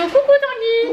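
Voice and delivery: high, playful hello; sing-song greeting. Real, not acted. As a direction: call out sweetly.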